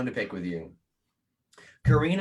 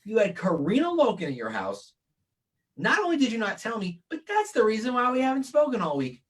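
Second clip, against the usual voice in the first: hammed-up indignant rant; mock angry; 11 semitones higher than usual. Mock scolding his cohost.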